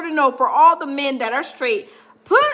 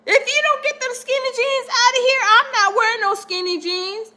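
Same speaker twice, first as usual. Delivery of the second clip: higher, indignant straight-guy protest; higher, outraged macho complaint; straight man refusing; 8 semitones higher than usual. Retold or imitated. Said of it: "a normal, average, straight man is gonna be like".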